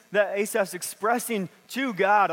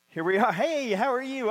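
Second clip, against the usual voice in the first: higher, bright put-on church hello; fake cheerful greeting; 5 semitones higher than usual. Parent at church door greeting people with mock cheer. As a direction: switch on the smile for the greeter.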